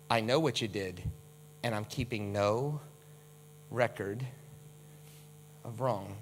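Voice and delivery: forgive gently, keeping nothing; tender forgiveness. Acted. God forgiving the listener, soft and tender.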